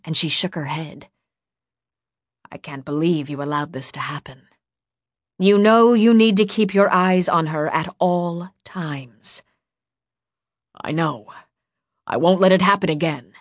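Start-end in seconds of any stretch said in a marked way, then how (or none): 2.5-4.5 subdued, weary reproach; tired disappointment. A narrator's reading. Woman shakes her head at the boy, tired disappointment. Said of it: "she just sounded tired". 5.4-9.4 slow, loud, emphatic insistence; stern reprimand. A narrator's reading. Woman reminds the boy of his duty, stern. 10.7-13.3 apologetic. A narrator's reading. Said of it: "Finn bowed apologetically".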